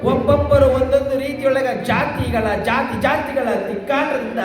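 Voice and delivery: high, sustained discourse intoning; declamatory preaching. Acted. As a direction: declaim it high like a devotional storyteller. (ಏರುದನಿಯ ಪ್ರವಚನ ಶೈಲಿ)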